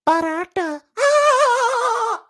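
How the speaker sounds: very high, hammed-up Indian accent; exaggerated Indian accent ending in a high yelp; mock Indian accent. Podcaster mocking accent TikToks, squeaky Indian accent. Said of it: "in an Indian accent".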